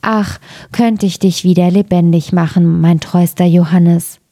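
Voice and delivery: ordinary delivery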